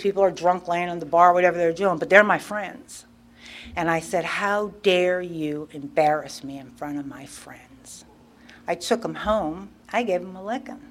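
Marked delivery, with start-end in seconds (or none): 4.2-8.0 low scolding. Retold or imitated. Replays her scolding of her son.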